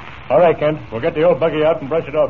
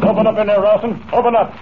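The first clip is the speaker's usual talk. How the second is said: calling out; 6 semitones higher than usual. Acted. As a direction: demand he open up, voice raised high. Comanche pounds on Rawson's door.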